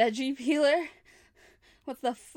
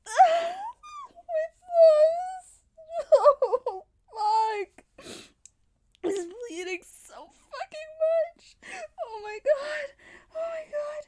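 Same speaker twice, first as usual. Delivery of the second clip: high, teary, broken cries; crying in pain; 12 semitones higher, 5 dB louder, much slower than usual. Acted. Bleeding captive wails over her wounds, crying in pain. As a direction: sob through the pain.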